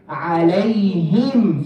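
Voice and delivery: عليهم drawn out, ending in a clear "him". Shown as a demonstration. Teacher models عليهم slowly for students, clear "him" ending. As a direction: hold a pure i in the last syllable of عليهم. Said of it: "Say that".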